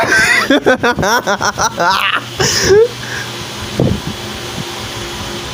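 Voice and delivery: long giddy laugh trailing off. Real, not acted. Streamer laughs at losing. (risada longa)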